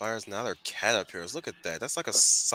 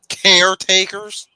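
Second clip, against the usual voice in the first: high loud slow incredulous question; stretched, outraged caretakers; shrill incredulous caretakers; 8 semitones higher, 13 dB louder, slower than usual. Pretend. Repeating word in mock voice.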